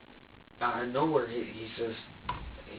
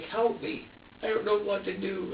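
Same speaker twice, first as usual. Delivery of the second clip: higher helpless little voice; voicing the baby; 6 semitones higher than usual. Father voicing his baby's helpless look, higher pleading. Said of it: "he's just looking at me like".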